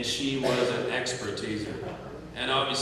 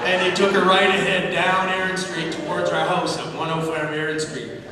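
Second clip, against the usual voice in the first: ordinary talk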